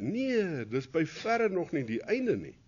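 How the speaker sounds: usual speaking voice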